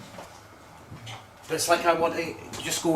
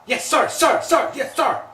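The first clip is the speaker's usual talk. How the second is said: rapid volley of high, loud shouts; imitated shouting; 11 semitones higher, 8 dB louder than usual. Medium shows the others how he keeps shouting, loud and high. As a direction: shout it over and over like a soldier answering. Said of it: "That's how he's shouting".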